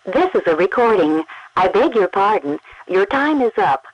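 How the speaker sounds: normal talking voice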